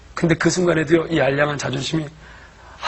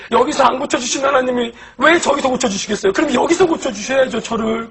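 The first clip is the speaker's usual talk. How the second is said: raised, high-pitched protest, imitating an outburst; retold desperate yelling; 7 semitones higher, 7 dB louder than usual. Patient yelling at mother-in-law over being healed here, retold loud protest. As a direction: re-enact shouting back, louder and higher. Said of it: "장모님한테 소리 지르면서".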